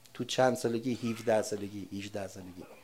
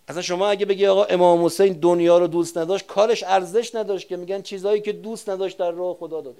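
louder, higher impassioned preaching; preaching at full force; 5 semitones higher, 9 dB louder than usual. Real, not acted. Preacher arguing to congregation that Hussein loved the world, loud fervent preaching. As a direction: drive the point home to the crowd.

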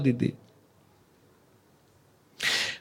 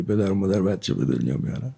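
mocking the wrong belief; 9 semitones lower, 7 dB quieter than usual. Shown as a demonstration. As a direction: lower and softer, a scoffing aside. (با لحن تمسخرآمیز و آهسته)

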